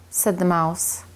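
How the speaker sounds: ordinary delivery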